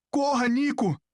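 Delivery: high, urgent call; acted shout without full volume; shouted warning. A narrator's reading.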